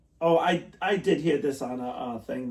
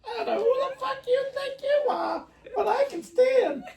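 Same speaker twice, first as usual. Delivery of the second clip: mock indignation; 4 dB louder than usual. Mock-indignant comeback to friend.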